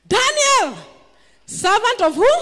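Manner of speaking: high, loud call down into the den; anxious call. Acted. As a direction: shout his name, anxious and hopeful. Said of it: "he called out anxiously".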